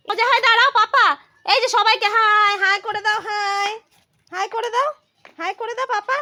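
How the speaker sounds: high, animated parent voice; loud at first, high-pitched cheerful coaxing. Real, not acted. Coaxing child to say hi.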